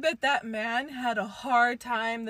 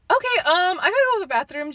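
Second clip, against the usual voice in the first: high, loud acted announcement; raised-voice pretend casual excuse; announcing an excuse; 7 semitones higher, 6 dB louder, much faster than usual. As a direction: pipe up loudly to make an excuse. Acts out excusing herself to friend.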